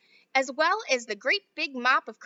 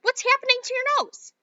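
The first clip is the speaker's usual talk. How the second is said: high, loud panicked cry; shrill with fright over her daughter; mother panics at her daughter's nose; alarmed mother; 13 semitones higher, 7 dB louder than usual. Acted.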